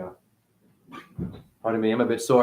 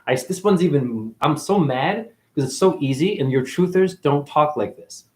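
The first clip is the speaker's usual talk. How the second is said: normal talking voice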